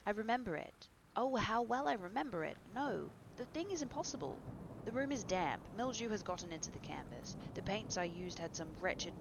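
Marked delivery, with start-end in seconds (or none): 0.0-5.7 loud, raised, insistent outburst; high-pitched, emphatic disbelief; agitated denial. Acted. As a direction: protest with mounting agitation. Basil's agitated denial of the portrait.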